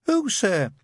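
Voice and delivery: a meek, polite recruit; timid young private. A narrator's reading.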